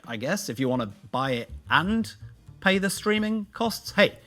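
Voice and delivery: ordinary talk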